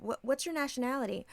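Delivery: imitated nosy questioner. Mimicking a stranger asking about nationality, lightly put on.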